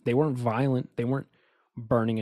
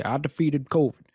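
Biden impression; 4 semitones higher than usual. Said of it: "You're gonna see Biden come out and say".

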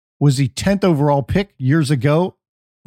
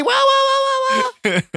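muffled wah wah talk. Retold or imitated. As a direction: imitate a muffled trombone wah. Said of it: "radio morning zoo show".

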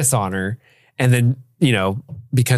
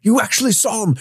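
high, rising mock excitement; so excited; 6 semitones higher than usual. Dad thrilled at his son's tomb find, imitated excitement. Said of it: "And he gets so excited".

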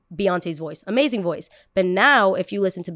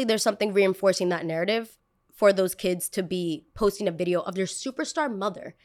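ordinary talk

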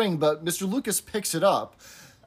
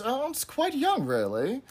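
Miss Slocum; 8 semitones higher, 4 dB quieter than usual. Retold or imitated. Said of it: "Miss Slocum thinks".

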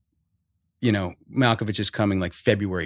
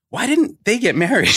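much higher, louder incredulous question; exasperated question; 11 semitones higher, 4 dB louder than usual. Retold or imitated. Fan asking why the couple never married, exasperated. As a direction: ask it like you can't believe it. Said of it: "I'm like".

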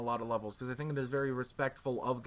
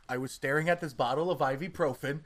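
usual speaking voice

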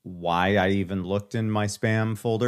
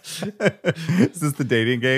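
slow, higher show host voice; game show host; 6 semitones higher than usual. Pretend. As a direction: ham it up like a TV host.

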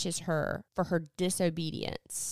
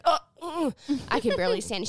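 mock gasp, higher and a bit louder; overwhelmed; 5 semitones higher, 4 dB louder, faster than usual. Book podcaster voicing an overwhelmed heroine, overwhelmed. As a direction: act out struggling to keep composure. Retold character overwhelmed, struggling to cope.

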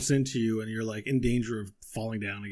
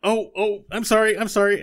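high, loud, apologetic little voice; flustered apology in a squeaky voice; high loud sorry sorry; flustered Buttons; 10 semitones higher, 9 dB louder than usual. Acted. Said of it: "He's like".

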